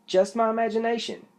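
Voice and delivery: high, unsure self-reassurance; lightly voiced jittery rabbit; nervous rabbit. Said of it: "he thought".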